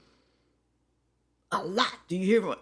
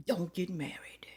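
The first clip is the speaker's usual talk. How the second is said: whisper; 7 dB quieter than usual. Shown as a demonstration. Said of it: "whispered repeat".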